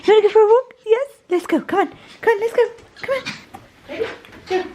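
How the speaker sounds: high, eager pet-owner voice; excited dog talk. Real, not acted. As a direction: rouse the dog for a walk, high-pitched. Rousing her dog for a walk.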